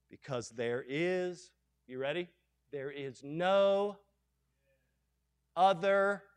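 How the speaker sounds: low, soft, slowed reading; solemn scripture reading. Acted. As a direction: let each word hang. Reading decree quietly, slowly.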